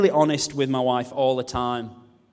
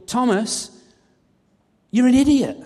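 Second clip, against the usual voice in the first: mock exasperated jab; 9 semitones higher, 5 dB louder than usual. Joke version of Jesus.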